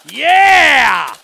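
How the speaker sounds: big high whooping yell; excited yell. Real, not acted. Thrilled whoop as the shotgun fires on screen. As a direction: let out a thrilled whoop at the gunfire.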